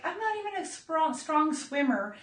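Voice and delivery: high, teary whimper; mock panic. [name] in the water answering friends, high teary whimper. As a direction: whimper it as if about to cry. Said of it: "And I'm like".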